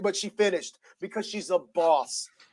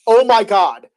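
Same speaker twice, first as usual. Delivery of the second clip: loud, higher gasped oh my God; shocked exclamation; 5 semitones higher, 12 dB louder, slower than usual. Retold or imitated. Watching Big E land on his head, a loud shocked oh my God. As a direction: blurt it out in shock. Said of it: "I remember audibly being like".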